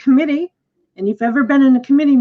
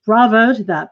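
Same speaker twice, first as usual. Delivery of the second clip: ordinary talk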